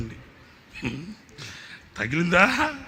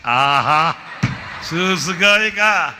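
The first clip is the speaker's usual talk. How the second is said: loud, slow drawn-out dare; pastor's mock dare; 2 semitones higher, 7 dB louder, much slower than usual. Pastor daring the young preacher, loud slow. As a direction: dare him, stretching every word.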